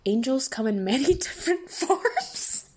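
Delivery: slow, rising into a high tearful laugh; choked up. Real, not acted. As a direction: break into a laugh-cry as you quote it. Choking up over a touching comment.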